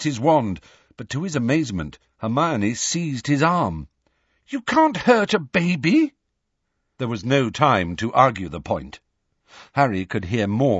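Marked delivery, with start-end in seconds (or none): 4.5-6.1 high, urgent objection; shocked protest. A narrator's reading. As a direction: cry out to stop him. Hermione protests, seizing his arm.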